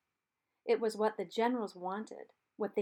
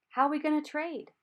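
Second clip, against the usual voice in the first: higher, louder call to the far bank; called back; 7 semitones higher, 6 dB louder than usual. A narrator's reading. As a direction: call back across the river. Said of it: "Charlie called back".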